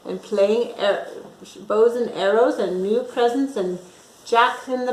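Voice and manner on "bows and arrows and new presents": usual speaking voice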